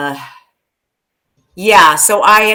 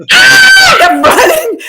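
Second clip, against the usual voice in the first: high, loud laughing scream; laughing terrified yell; mock panic; 8 dB louder, slower than usual. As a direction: shriek while running away. Fleeing shark in retold dream.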